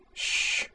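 soft unvoiced "shh"; shushing. A narrator's reading. Boomer to the man with the blaster, shushing. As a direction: hush them with a quiet hiss of air. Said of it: "she said".

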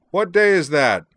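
high, loud shouted question; bellowed question. A narrator's reading. Zoner asks Azalin from far off, bellowing. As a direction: shout it back over the distance. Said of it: "Zoner bellowed back".

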